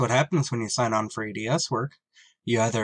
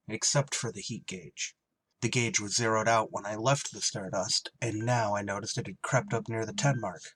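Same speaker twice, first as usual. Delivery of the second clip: story narration; 2 semitones lower, 6 dB quieter than usual. A narrator's reading. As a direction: tell it like a tense memory. Pilot narrates the discovery.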